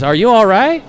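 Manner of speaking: high, sweet woman's voice; Daisy helping the stranger up; saloon girl Daisy. Acted. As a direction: gush your thanks like a saloon girl. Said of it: "she says".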